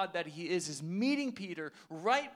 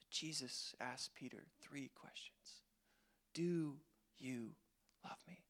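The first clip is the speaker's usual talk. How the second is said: whisper; 5 semitones lower, 12 dB quieter than usual. Shown as a demonstration. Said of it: "hushed retelling of the question".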